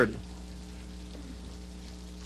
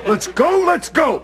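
excited; 10 semitones higher, 4 dB louder, slower than usual. Real, not acted. Eager to ditch chores.

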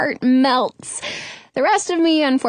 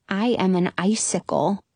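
usual speaking voice

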